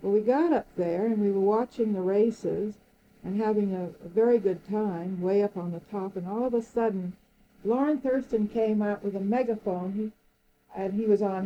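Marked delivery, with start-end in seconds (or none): none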